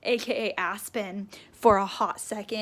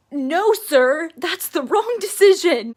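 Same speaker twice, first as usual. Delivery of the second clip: loud, higher, indignant no sir; outraged protest; 6 semitones higher, 12 dB louder, slower than usual. Real, not acted. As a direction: shout down a bad decision. Outraged at plot decision.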